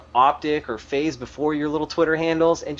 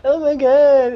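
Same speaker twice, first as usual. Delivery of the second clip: high, loud mock wail; crying fanboy; 11 semitones higher, 6 dB louder, slower than usual. Griping about fans after roster moves, mock crying fanboy. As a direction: wail it like a distraught fanboy, hammed up. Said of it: "every little fanboy's crying".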